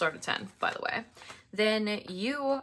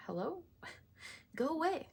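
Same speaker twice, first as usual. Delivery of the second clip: mock brush-off; 8 semitones higher, 8 dB quieter, faster than usual. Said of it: "I'm like".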